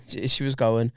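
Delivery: usual speaking voice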